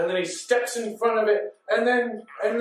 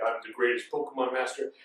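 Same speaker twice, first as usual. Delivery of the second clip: deep, softer villain voice; Mewtwo; 8 semitones lower, 7 dB quieter than usual. Retold or imitated. Mewtwo correcting his changed voice, deep boast. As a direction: sink low and quiet for the boast. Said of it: "I don't know why he changed his voice".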